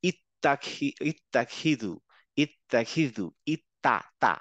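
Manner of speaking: ইত্তাখিদু with an open তা; Arabic word demo. Shown as a demonstration. Teacher repeats the word for students, open তা. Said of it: "মুখটা খুলে যাচ্ছে".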